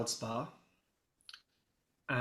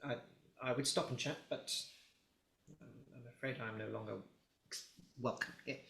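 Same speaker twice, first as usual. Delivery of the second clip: quiet aside; 9 dB quieter, faster than usual. Acted. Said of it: "and he's just like".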